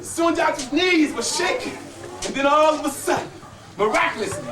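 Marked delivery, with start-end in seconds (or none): none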